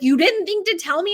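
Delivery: high, loud imitation of an indignant husband; mock indignation. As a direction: accuse in mock disbelief. Husband's retold indignant complaint.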